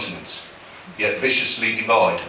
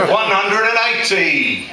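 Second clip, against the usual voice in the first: darts caller's cry; 9 semitones higher, 3 dB louder than usual. Acted. Comic mocking darts for an audience, darts caller's cry. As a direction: call the score out, sliding down at the end.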